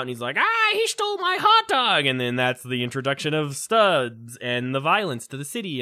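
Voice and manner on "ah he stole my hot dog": mock-panicked outcry, very high and louder; freaking out. Guy robbed by a zombie hand, freaking out in mock panic. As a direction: imitate a man wailing in comic alarm. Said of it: "freaking out".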